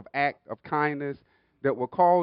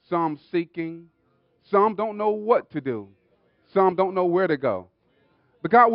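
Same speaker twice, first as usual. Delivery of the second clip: raised, projected pulpit prayer building in intensity; fervent public prayer; 3 semitones higher, 9 dB louder than usual. Real, not acted. Pastor thanking God before the congregation, fervent prayer. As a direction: call out to God in rising phrases.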